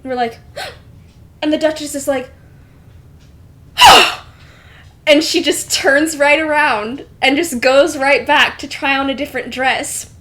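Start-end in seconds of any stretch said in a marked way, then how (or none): 3.8-4.2 sharp, loud, high gasp; shocked gasp. Acted. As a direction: gasp in horror at the matching dress. Duchess sees rival in pink.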